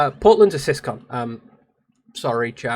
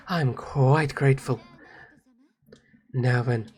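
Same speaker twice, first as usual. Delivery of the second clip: composed game character; 2 semitones lower, 4 dB quieter than usual. Acted. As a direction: speak with quiet formality to a lady.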